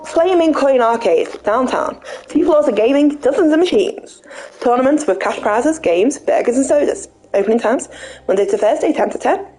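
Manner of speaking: upbeat flyer reading voice. Acted. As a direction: read it like an ad. Reads out arcade flyer.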